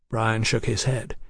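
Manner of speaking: ordinary delivery, nothing marked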